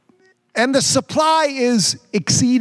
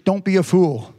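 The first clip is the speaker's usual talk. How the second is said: ordinary delivery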